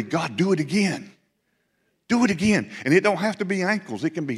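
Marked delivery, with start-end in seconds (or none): 0.0-2.7 fervent prayer. Acted. Preacher voicing the church's plea to God, fervent and rising. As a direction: cry out to God to repeat the miracle.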